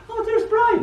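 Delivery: high surprised exclamation with a laugh; surprised exclaim. Retold or imitated. Family seeing the dad's old photo, high surprised laugh. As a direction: spot the brother in the old photo. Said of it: "we'd go".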